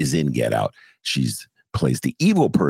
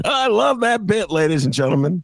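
hammy host; 13 semitones higher than usual. Pretend. Host praising his own bit, high hammed-up showman. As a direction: stretch it out like a showman.

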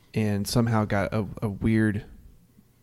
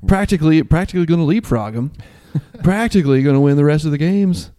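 raised, louder pundit voice; mock sports-show hype; 6 semitones higher, 6 dB louder than usual. Co-host mimics shows teasing Kansas's chances, mock hype. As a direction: hype it up like a breathless TV tease. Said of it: "tidbit in on all the shows where you see".

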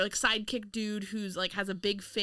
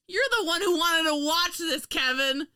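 high, loud comeback; raised-voice mock outrage; indignant accusation; 8 semitones higher, 6 dB louder than usual. Real, not acted. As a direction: throw the blame right back. Turns the blame on co-host.

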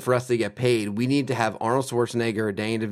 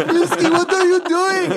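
much higher, loud, amused scold; Arnold scolding horse; 9 dB louder than usual. Retold or imitated. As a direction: squeal at the horse in a put-on voice. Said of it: "He's like".

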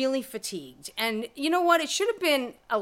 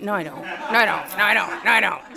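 from plain to louder, laughing; mock denial growing louder; building denial; 5 dB louder than usual. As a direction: repeat it, louder each time.

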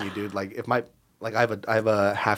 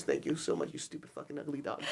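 soft insult to dog; 3 semitones lower, 13 dB quieter than usual. Retold or imitated. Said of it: "I'm like".

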